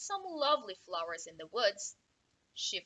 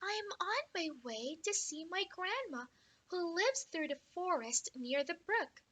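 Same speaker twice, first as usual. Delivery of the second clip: put-on little girl voice; 6 semitones higher, 4 dB quieter than usual. Girl answers wolf.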